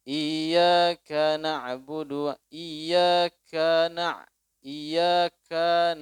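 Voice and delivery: "iyyaka" very slow, the kaf only lightly separated; thin kaf separation. Shown as a demonstration. Preacher models the best reading with a thin separation, slow. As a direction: recite "iyyaka na'budu" slowly with a thin pause at the kaf. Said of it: "yang bagusnya agak tipis dia".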